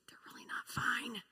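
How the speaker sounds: unvoiced whisper; whispering what she wants to tell parents; whispered aside. Real, not acted. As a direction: mutter it under your breath. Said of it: "whispered aside".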